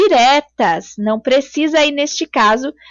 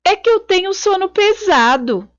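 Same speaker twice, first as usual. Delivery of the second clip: man's punchline; 7 semitones higher than usual. Acted. Teacher voices man's punchline.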